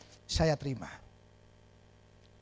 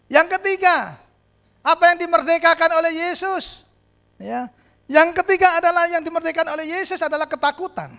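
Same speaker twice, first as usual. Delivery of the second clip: high, loud sermon point; preaching at full force; 9 semitones higher, 8 dB louder than usual. Acted. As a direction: announce it boldly.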